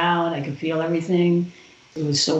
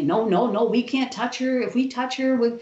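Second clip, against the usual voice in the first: higher, anxious nurses saying no; retold refusal; 7 semitones higher than usual. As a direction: mimic the nervous nurses backing off. Nurses refusing to touch her.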